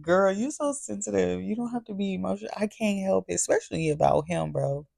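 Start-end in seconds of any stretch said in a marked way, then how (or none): 0.0-2.5 imitating cousin. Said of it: "my little cousin talking about".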